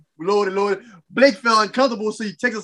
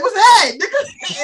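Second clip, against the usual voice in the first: mock challenge; 9 semitones higher, 3 dB louder, slower than usual. [name] challenging a stranger.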